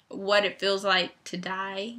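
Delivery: ordinary talk, nothing marked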